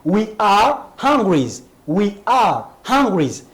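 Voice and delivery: très lentement, hungry bien détaché; épelle la phrase au ralenti pour la classe; lent et articulé. Shown as a demonstration. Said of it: "La dernière phrase ici". (le prof répète we are hungry très lentement)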